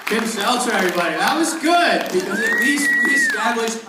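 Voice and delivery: loud, high, hyped announcing; loud hype. Real, not acted. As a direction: pump up the crowd. Host loudly hyping guest.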